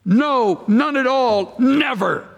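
high, punched NO, NONE AT ALL, NEVER; emphatic negation. Shown as a demonstration. Preacher showing the force of the Greek "no", emphatic negation. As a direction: hit "No", "none at all", "never" as separate blows. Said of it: "way out of place and emphatic".

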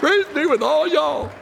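high-pitched, delighted proclamation; gleeful cry. Real, not acted. Pastor repeating the verse Southern-style, high and gleeful. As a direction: proclaim it high, savouring the punchline.

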